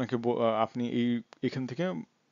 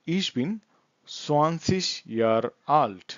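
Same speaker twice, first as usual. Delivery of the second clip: very slow, word-by-word German; slow language demo; much slower than usual. Shown as a demonstration. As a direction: space each word out for learners. Teacher models German answer. (শব্দে শব্দে খুব ধীরে)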